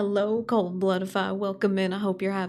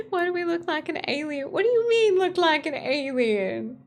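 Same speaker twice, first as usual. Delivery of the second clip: high-pitched incredulous protest; playful indignation; 8 semitones higher than usual. Real, not acted. Streamer to chat over an alien remark, indignant. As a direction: start high, drag out the repeat.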